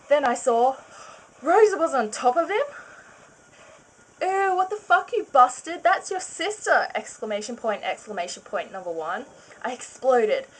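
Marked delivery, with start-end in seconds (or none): 0.1-2.7 shocked build. A narrator's reading. Shocked narration of discovery. 4.2-6.9 high, loud disgusted yell; outraged yell. Acted. Narrator finds husband with his sister, high mock outraged yell.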